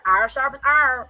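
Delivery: "Iron" said as "arr" with a rolling r; pirate-sounding iron. Shown as a demonstration. Host laughing that her accent sounds pirate. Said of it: "I sound like a pirate".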